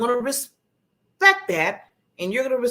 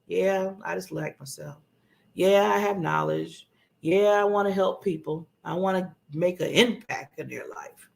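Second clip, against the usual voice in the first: low, lacklustre self-talk; low, half-hearted and unsure; unconfident; 4 semitones lower than usual. Shown as a demonstration. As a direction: say it with no conviction at all. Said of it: "That's not com-, you have to sound confident".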